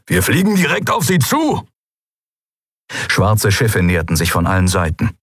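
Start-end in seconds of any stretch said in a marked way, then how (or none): 0.1-1.7 high, agitated growling protest; growled protest. Acted. Keru objects to flying straight at them, growling. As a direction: protest angrily with pitch pushed up. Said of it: "grollte Keru die Shayang an".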